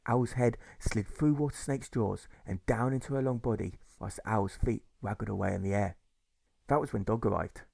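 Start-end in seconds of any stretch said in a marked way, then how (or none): none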